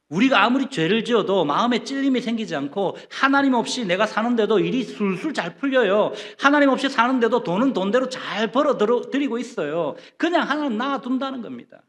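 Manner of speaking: loud, high-pitched sermon delivery; forceful preaching. Acted. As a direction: drive it home from the pulpit.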